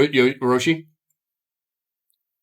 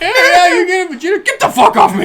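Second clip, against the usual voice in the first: high, loud cartoon outburst, laughing; mock angry yell; 8 dB louder than usual. Retelling the fight with Vegeta, loud mock yell. Acts out Goten clinging to Vegeta.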